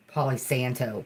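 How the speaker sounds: a lightly done country-accent "Palo Santo". Shown as a demonstration. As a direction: say "Palo Santo" like a rural Southerner. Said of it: "I said it like a hillbilly".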